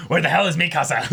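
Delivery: giggling disbelief at how scary she was; laughing. Real, not acted. Joking about Mikasa's terrifying fight.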